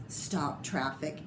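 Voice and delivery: plain reading voice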